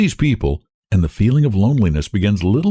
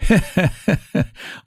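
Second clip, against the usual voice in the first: a sad little laugh; old cabman's strained chuckle; Iona's laugh; 10 semitones higher than usual. A narrator's reading. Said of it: "laughs Iona".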